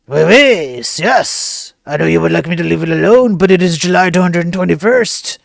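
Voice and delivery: hammed-up French officer; French cop refusing the hacker, comic accent; mock French accent. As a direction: answer the beggar as a pompous French cop.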